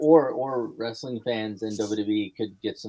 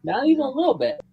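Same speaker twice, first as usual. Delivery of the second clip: indignant denial; 11 semitones higher, 3 dB louder, faster than usual. Real, not acted. Indignant rejection of comparison.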